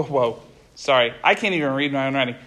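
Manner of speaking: ordinary talk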